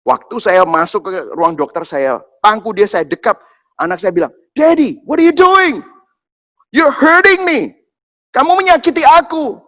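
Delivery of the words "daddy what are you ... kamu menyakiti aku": high, loud, drawn-out protest; imitated child's screaming. Toddler protesting to daddy at the doctor, screaming. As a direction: wail like a hurt toddler. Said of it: "dia tuh teriak-teriak".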